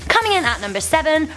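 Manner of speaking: quick, high countdown call; top ten countdown. Acted. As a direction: announce the next entry. Countdown announcement.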